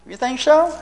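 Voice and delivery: high, teasing challenge; mock doubt. Preacher teasing himself about waiting to read, mock doubt. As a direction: question your own promise, disbelieving. Preacher mocks his own resolve.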